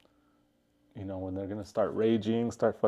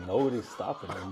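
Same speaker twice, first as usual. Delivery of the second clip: ordinary delivery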